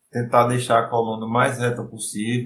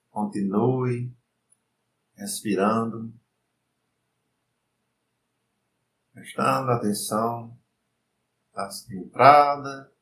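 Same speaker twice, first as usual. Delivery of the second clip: guided meditation; 3 dB quieter than usual. Acted. Leads breathing meditation.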